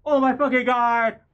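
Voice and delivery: high, loud overjoyed cry; excited exclamation. Real, not acted. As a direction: cry out in disbelief. Still celebrating.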